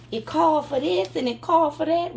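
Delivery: high, loud church-lady sass; Mama Hattie's voice. Retold or imitated. Imitating Mama Hattie telling her it's nothing, loud and high. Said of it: "That's how she talk".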